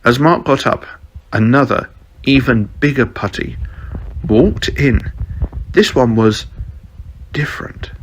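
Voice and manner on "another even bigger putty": plain reading voice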